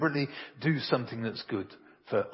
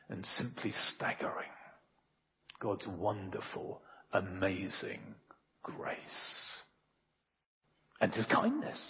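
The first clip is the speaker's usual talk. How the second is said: hushed awe; 6 semitones lower, 10 dB quieter than usual. Acted. Preacher's hushed climax.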